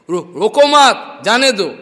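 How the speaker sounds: loud "रो-, रोको मत", then "जाने दो" apart; stammered wrong grouping. Retold or imitated. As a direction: stammer on "रो", join "मत" to "रोको", break before "जाने दो". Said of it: "थोड़ा स्टैमरिंग हुआ मुख में". (हकलाकर ज़ोर से "रोको मत", फिर अलग से "जाने दो")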